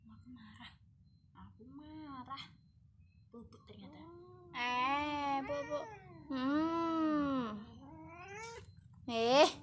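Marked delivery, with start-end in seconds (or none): 0.1-4.0 very soft cat voice. Pretend. Speaking for the sulking cat.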